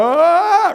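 high-pitched pretend scream, played for laughs; mock scream. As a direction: let out a hammed-up "ah" of alarm. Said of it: "Reed starts screaming".

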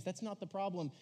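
ordinary talk, nothing marked

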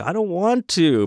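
high, drawn-out mock protest; reluctant protest. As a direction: imitate a sulky refusal, slow and high. Rick refuses to switch TV off.

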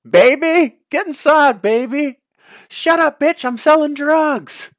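hammed-up squeaky shouting character; Chappelle skit character. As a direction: squeal it like a sketch comedy street character.

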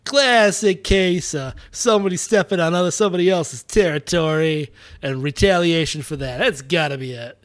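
loud Boston cop voice; Boston accent. Retold or imitated. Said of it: "Your, your Boston accent, flawless".

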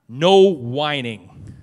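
"No whining" loud and very drawn out; a slow, heavy stress on each word of "No whining"; emphatic sign reading. Acted. Preacher reads fridge sign, "No whining" slow and emphatic.

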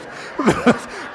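a burst of breathy laughter; loud laugh. Real, not acted. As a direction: laugh out loud at the absurd story. Preacher laughing at own story.

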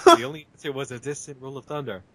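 ordinary delivery

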